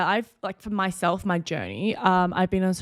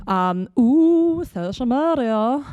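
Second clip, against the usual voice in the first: excited ooh; 7 semitones higher, 5 dB louder, much slower than usual. Pretend. Mock excited intro to topic.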